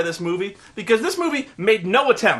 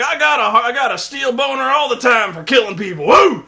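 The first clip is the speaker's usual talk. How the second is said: higher, louder whooping colonel; building to a whooping laugh; hammed-up macho shout; 7 semitones higher, 6 dB louder than usual. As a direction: bellow it like a bloodthirsty Marine. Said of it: "he's like".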